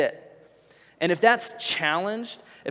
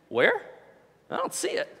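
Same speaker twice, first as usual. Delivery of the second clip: put-on high, puzzled little-kid voice; toddler voice; 8 semitones higher than usual. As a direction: play the confused two-year-old looking around. Toddler son voiced by father.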